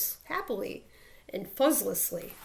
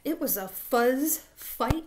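ordinary delivery, nothing marked

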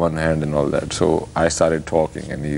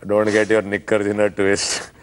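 Aussie slang in a thick Haryanvi accent. Shown as a demonstration. Host asks for Aussie slang, Haryanvi accent. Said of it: "slang in a Haryanvi accent".

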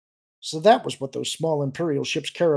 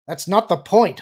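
irritated, raised retort; louder, higher and exasperated; biting; 6 semitones higher, 8 dB louder than usual. A narrator's reading. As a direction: bite out the words. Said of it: "Han bit out".

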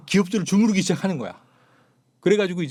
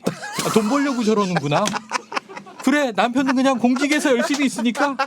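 high-pitched, louder mock husband's musing; indulgent husband; 8 semitones higher, 4 dB louder than usual. Husband excusing his wife's money-making, high mock-indulgent voice. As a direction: play the doting husband, pitched well up.

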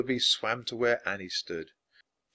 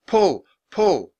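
loud, high urging; high, pressing command; urgent cry; 7 semitones higher, 7 dB louder than usual. A narrator's reading. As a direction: cheer them on forcefully. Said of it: "cried Jack".

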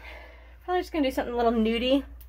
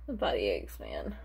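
weary sigh; 13 semitones lower, 7 dB quieter than usual. Real, not acted. Sighing about the aches.

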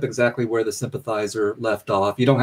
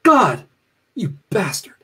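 crying out in blame; 5 semitones higher than usual. A narrator's reading. The non-faithful cursing God in the storm, crying out. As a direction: curse heaven, first word punched. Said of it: "The non-faithful cried out".

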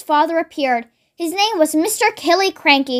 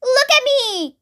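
high, stretched call; attention-seeking shout; 8 semitones higher than usual. A narrator's reading. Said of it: "Grandma shouted from the rooftop".

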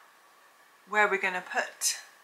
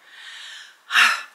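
breathy panting sigh; 5 semitones higher, 8 dB louder than usual. Real, not acted. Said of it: "I'm panting now".

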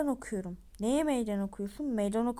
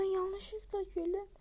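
mock crying; 8 semitones higher, 3 dB quieter, slower than usual. Accused character pleads.

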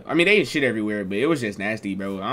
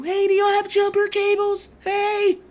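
much higher, calling out to strangers; slower than usual. Retold or imitated. Sister calls to strangers in the lot for jumper cables, high. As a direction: pitch way up like the sister hailing people.

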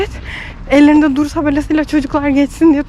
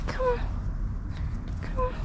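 very high, soft coo to a cat; high hushed calls to a timid cat; soft coaxing; 13 semitones higher, 14 dB quieter than usual. Real, not acted. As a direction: coo gently so it won't run.

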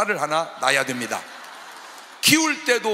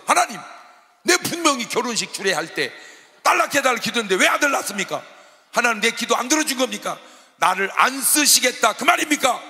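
raised, insistent voice of protest; arguing with God; 7 semitones higher, 4 dB louder than usual. Retold or imitated. Preacher protests to God over his prayer, indignant demand. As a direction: press the complaint, higher and louder by the end. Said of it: "심각해서 하나님께 따져 물었어요".

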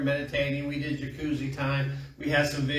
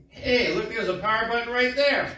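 higher-pitched mock surprise; mock discovery; 7 semitones higher, 3 dB louder than usual. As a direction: act out a sudden happy find. Acts out spotting the button.

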